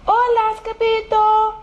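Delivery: high, held-out hola; chihuahua greeting. A narrator's reading. Chihuahua leader hailing an old friend, high greeting. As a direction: call it on one high note.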